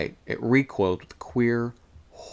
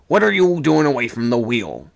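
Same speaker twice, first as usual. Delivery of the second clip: loud, raised stern questioning; 4 semitones higher, 8 dB louder than usual. A narrator's reading. Old man to sailor off his post, stern. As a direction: demand an answer with authority. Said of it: "in a stern voice".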